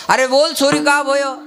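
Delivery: Vrindavan villager. Acted. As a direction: ask the excited girl like a Vrindavan elder. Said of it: "ही वृंदावनातली भाषा आहे".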